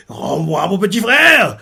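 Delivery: slow, shouted, tearful plea. Retold or imitated. Soya in tears and bleeding, shouting for his brother. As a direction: cry it out, slowed and breaking. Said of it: "Soya en larmes, en sang".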